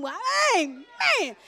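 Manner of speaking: slow drawn-out mock praise; a drawn-out "Well, amen" in church; mock shout. Preacher retelling her church shout, slow and drawn out. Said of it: "I was shouting".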